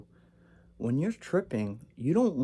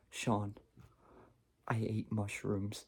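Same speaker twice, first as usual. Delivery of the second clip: very soft, subdued admission; meek confession; 3 semitones lower, 10 dB quieter, slower than usual. Retold or imitated. Confessing to his laughing brother, meek and quiet. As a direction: confess it quietly, halting after the name.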